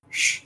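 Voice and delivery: bare "sh" sound, no vowel; sh as a lone hushing sound; isolated voiceless sh; sounded-out consonant. Shown as a demonstration. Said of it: "the T I is pronounced".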